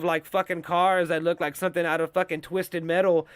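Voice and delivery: ordinary talk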